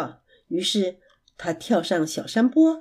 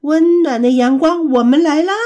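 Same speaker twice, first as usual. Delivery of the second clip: louder and higher, joyful cry; happy shout; 5 semitones higher, 5 dB louder than usual. Acted. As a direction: shout with glee on take-off. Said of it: "他开心地大喊". (开心地大声欢呼)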